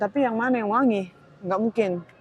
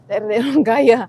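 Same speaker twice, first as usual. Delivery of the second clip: laughing through the words, louder; 8 dB louder than usual. Real, not acted. To viewers, recalling posh areas of KL, laughing. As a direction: chuckle out the punchline. Joking about rich neighbourhoods.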